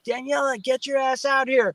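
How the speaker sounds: high call to the wife outside; mild retold yell across the yard. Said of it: "I scream for my wife".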